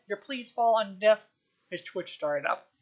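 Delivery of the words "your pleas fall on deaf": slow, loud pompous declaration; a duke bellowing his scorn; loud ranting duke. Acted. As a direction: thunder it at the prisoner. Duke ranting at captive witch.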